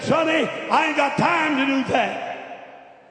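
high, drawn-out fatherly scolding; exasperated refusal. Retold or imitated. Tired father refuses boy's plea.

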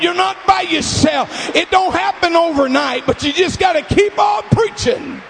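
high, loud preaching shout, rising in fervor; full-force preaching. Acted. Pastor rallying home missionaries, preaching in a loud shout.

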